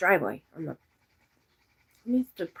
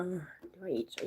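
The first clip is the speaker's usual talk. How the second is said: ordinary talk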